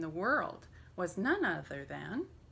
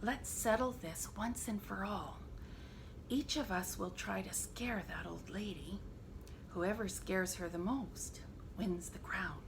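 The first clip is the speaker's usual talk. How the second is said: whispering; 6 dB quieter than usual. A narrator's reading. Lion whispering his plan to the animals. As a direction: plot it in a hush. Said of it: "he whispered".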